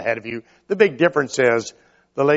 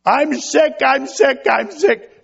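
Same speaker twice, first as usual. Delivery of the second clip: much higher, louder mock wail; fake sick baby; 12 semitones higher, 4 dB louder, slower than usual. Baby wailing for mom to pick him up, hammed-up whine. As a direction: whine it like a baby faking illness.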